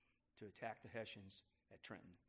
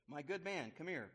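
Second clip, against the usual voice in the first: louder, higher summons to the courier; demanding call; 6 semitones higher, 7 dB louder than usual. Acted. Paine calls the army courier over, demanding. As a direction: call him over like an order. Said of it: "Paine demanded".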